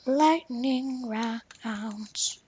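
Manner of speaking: slow, soft stretched-out call; drawn-out announcer call. Pretend. Player replaying the announcer line, soft and drawn-out. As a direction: stretch it out slowly and quietly. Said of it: "I, I just love going like that".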